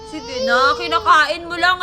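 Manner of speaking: loud sobbing plea; crying. Real, not acted. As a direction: wail it out through sobs. Child crying, pleading.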